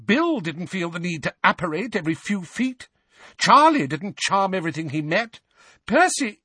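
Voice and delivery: high, mounting tirade that breaks off; raging. A narrator's reading. Mother lists the better-behaved brothers, raging. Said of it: "Missus Weasley raged at the twins".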